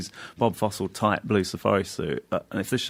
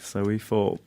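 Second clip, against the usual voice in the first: normal talking voice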